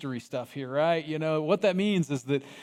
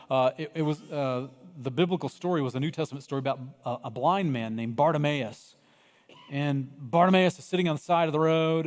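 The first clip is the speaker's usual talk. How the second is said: normal talking voice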